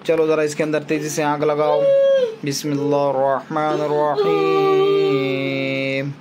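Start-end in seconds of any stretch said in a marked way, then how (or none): 2.4-6.1 slow, low chanted Arabic recitation; Quranic recitation. Real, not acted. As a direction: intone the verses slowly like a prayer. Recites Quran verses in exorcism. (धीमा, गहरा क़ुरआन पाठ)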